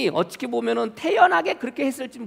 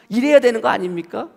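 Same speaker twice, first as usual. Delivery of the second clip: usual speaking voice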